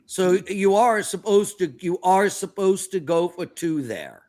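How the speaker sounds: ordinary talk, nothing marked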